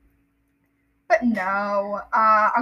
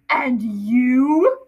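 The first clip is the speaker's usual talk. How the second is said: stern Uncle Vernon; 6 dB louder than usual. Acted. Vernon turns on Harry.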